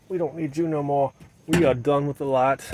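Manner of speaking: normal talking voice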